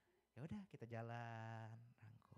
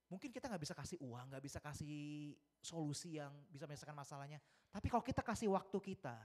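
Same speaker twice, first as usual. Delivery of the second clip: preaching; 3 semitones higher, 8 dB louder than usual. Acted. Preaching appeal to the congregation.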